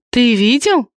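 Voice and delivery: light astonishment; surprised. A narrator's reading. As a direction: ask with a big rising lift. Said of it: "удивилась Ри".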